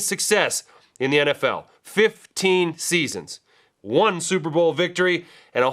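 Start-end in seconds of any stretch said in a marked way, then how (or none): 1.8-3.4 drawn-out FIFTEEN SEASONS, each word weighted; slow emphasis. Real, not acted. Host stressing a coach's long tenure, slow emphasis. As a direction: stretch out the figure to let it sink in.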